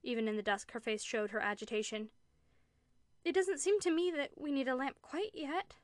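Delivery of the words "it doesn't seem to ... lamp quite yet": put-on high, pleading child's voice; piteous, childlike pleading. A narrator's reading. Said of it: "piteous, pleading voice like a child's".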